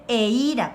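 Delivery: "eira" with the I stressed; slow model "eira", accent on its middle I. Shown as a demonstration. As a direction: lean on the "i" in "eira". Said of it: "con acento en la I".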